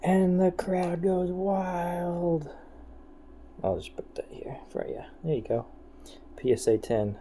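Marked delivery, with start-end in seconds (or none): 0.0-2.5 slow, high mock sports announcer. Mock announcer after pull. 3.6-5.0 whispering. Real, not acted. Whispering to cat.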